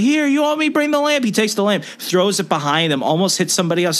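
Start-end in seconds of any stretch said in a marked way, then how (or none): 0.0-1.3 high, whiny stoner voice, much higher; mock hippie yell. Retelling the airport thief yelling at TSA in a high whine. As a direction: squeal it like an indignant burnout. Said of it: "He's like".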